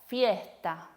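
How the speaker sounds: "fiesta" slowly, with an h for the s; aspirated S. Shown as a demonstration. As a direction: breathe out the s in a drawn-out "fiesta". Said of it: "decimos fiesta".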